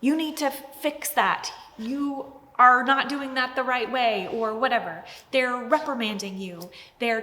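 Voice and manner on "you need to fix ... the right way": a hint of a stern parent telling off a child; mock reprimand, a touch louder; lightly voiced parent rebuke; scolding parent. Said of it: "They say".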